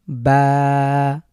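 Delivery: বা held clearly in a careful recital; careful recitation. Shown as a demonstration. Tutor reciting the first letters for students, held. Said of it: "একটু শুদ্ধ করে পড়ার try করি".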